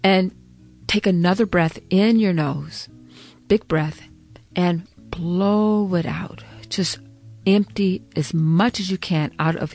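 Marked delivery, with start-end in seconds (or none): none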